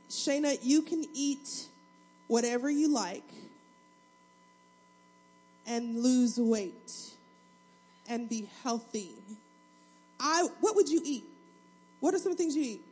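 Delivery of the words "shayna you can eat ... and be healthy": slow, wistful imagined God. Retold or imitated. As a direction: savour each word of the dream. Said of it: "I wish that God would say".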